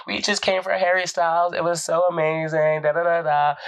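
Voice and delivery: high-pitched imitation of excited fans; gushing fans. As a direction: gush like a starstruck concertgoer. Acted [name] fans gushing.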